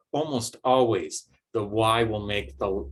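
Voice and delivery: ordinary talk, nothing marked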